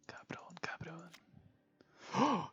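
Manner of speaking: breathy spooky whisper. Pretend. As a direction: whisper the name twice. Whispered name.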